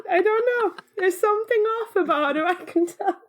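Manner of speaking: high-pitched character impression; Zuko. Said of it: "The way that Zuko, in his fight with his sister, said".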